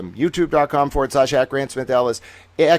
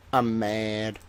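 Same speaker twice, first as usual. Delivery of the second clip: much softer, lower deflated aside; quiet grumble; 3 semitones lower, 6 dB quieter than usual. Acted. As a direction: say it under your breath, sulking. Same words muttered quietly.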